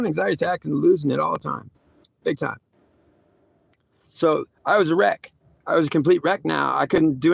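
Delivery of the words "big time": ordinary delivery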